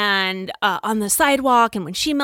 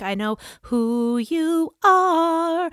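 brave quote; 7 semitones higher, much slower than usual. Retold or imitated. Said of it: "confronting Taka".

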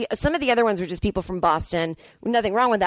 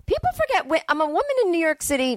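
high, squeaky outraged voice; indignant squeal; 9 semitones higher than usual. Real, not acted. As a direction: pitch way up in disbelief. Rising indignant aside.

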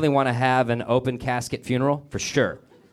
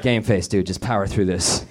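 inner pep talk; 6 semitones lower than usual. Retold or imitated. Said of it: "It's like".

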